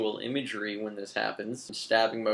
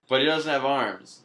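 usual speaking voice

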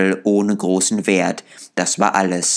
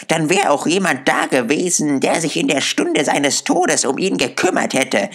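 put-on high-pitched defiant old charwoman; lightly voiced, brazen and challenging; a hint of a coarse, scornful old woman; hostile charwoman; 8 semitones higher than usual. A narrator's reading.

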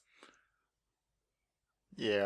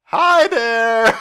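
shouted greeting; 8 semitones higher, 5 dB louder than usual. Pretend. Mock greeting to enemy.

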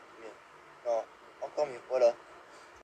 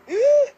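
loud high gasp; 10 dB louder than usual. Real, not acted. Sudden shock in game.

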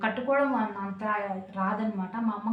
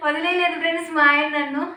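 emphatic loud denial; 6 semitones higher, 7 dB louder than usual. Real, not acted. Denies rumour husband left her.

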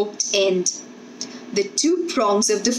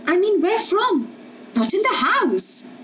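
nervous protest; 10 semitones higher than usual. Acted.

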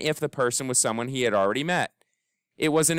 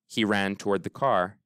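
ordinary delivery

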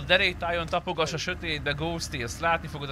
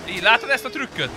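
proud boast; 8 semitones higher, 6 dB louder than usual. Real, not acted. Showing off driving trick.

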